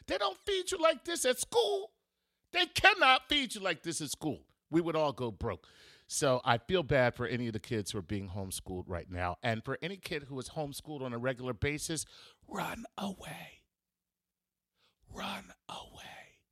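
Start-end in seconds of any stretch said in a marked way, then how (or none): 0.1-4.4 much higher, hammed-up exasperated voice; mock outraged dad. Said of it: "he was like". 12.5-16.4 slow conspiratorial whisper. Acted. Urging homeschooled kids to flee.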